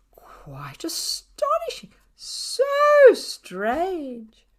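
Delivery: high, slow hammed-up amazement; theatrical fake wonder; mock astonishment. A narrator's reading. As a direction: overact the surprise sarcastically.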